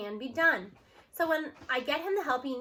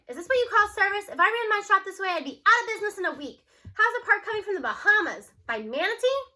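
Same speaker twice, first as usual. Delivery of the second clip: louder, higher, indignant jabs; indignant complaint; 6 semitones higher, 5 dB louder than usual. Acted. Jake berating the mechanic over the delayed part, indignantly. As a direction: scold the mechanic for his lousy service.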